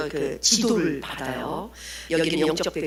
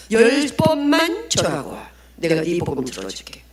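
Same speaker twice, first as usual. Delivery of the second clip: forceful demand; 5 dB louder than usual. Acted. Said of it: "강력한 포스가 있더라고요".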